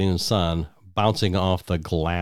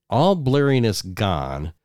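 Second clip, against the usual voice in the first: ordinary delivery, nothing marked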